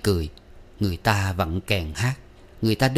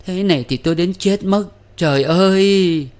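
high, loud sobbing cry; sobbing lament; 10 semitones higher, 6 dB louder than usual. A narrator's reading. Bride sobs that she will die of grief, high and loud. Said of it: "vẫn thổn thức".

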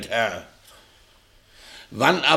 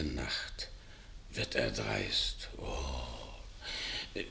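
fearful; 10 semitones lower, 15 dB quieter, much slower than usual. Acted. Mopsus ängstlich, tief und leise.

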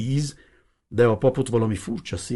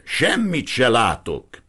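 shouted; 5 semitones higher, 6 dB louder, slower than usual. Acted. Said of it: "ordította bele a vénember fülébe".

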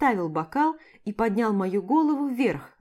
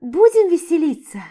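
loud giddy high plea; giddy pleading; 7 semitones higher, 9 dB louder than usual. A narrator's reading. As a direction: beg him to have fun. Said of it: "умоляюще прошептала".

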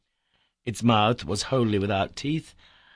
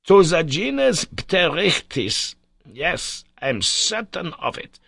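slow, higher, louder announcement of the genus; triumphant professor; 8 semitones higher, 5 dB louder, slower than usual. A narrator's reading. Professor finally names the genus, triumphant. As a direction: proclaim the name with triumph.

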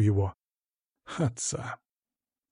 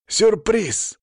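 high-pitched surprise reveal; excited call; 9 semitones higher than usual. Acted. Friends revealing a cake to Саня, calling out excitedly. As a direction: call it out to the birthday guest.

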